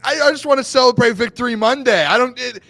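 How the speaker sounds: real agitated fan excitement; worked up. Real, not acted. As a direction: get loud and high, pushing your co-host. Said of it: "I'm getting worked up".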